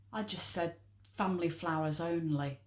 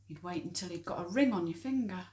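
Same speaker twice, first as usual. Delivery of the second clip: normal talking voice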